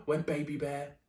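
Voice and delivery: ordinary delivery, nothing marked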